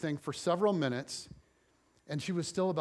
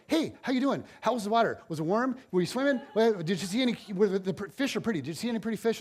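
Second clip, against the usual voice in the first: high-pitched chatty kid impression; cheerfully clueless child voice, pitched up; oblivious kid; 5 semitones higher, faster than usual. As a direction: play an oblivious twelve-year-old firing off questions. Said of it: "just oblivious to what's going on".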